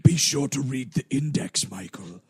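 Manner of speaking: low, mocking villain; sinister narrator voice.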